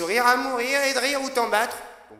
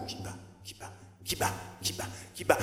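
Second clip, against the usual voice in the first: whisper=43; 4 semitones lower, 12 dB quieter, faster than usual. Acted. Whispered refrain in poem.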